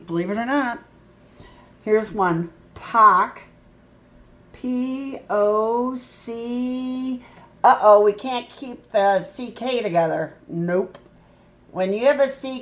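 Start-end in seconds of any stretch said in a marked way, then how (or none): 4.5-7.2 letters named one by one, slowly; very slow letter-by-letter spelling. Shown as a demonstration. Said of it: "spelling letters slowly".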